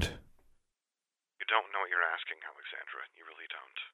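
very soft, distant telephone voice; voice over the phone. Acted. Sister answering over the phone.

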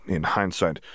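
ordinary delivery

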